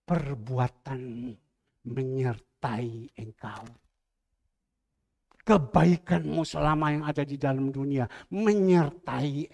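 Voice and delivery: very slow, low proclamation; solemn. Real, not acted. Pastor tells the church their deeds follow them, slow and solemn. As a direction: draw out each word with weight.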